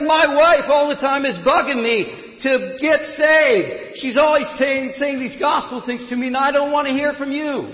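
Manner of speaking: loud, high, furious acted tirade; angry businessman yelling his complaint; livid ranting. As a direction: rant at the top of the voice, fed up. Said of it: "became livid".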